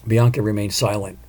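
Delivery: plain reading voice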